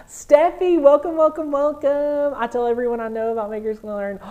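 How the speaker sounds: gasp, then high, loud greeting; excited welcome. Real, not acted. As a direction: greet a new member with a thrilled outburst. Greeting a new member excitedly.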